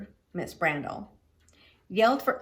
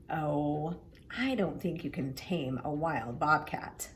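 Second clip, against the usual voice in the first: soft, hushed disbelief; quiet doubtful murmur of a father; 5 semitones lower, 6 dB quieter, slower than usual. A narrator's reading. Father to the mailman, softly doubting. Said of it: "my father said".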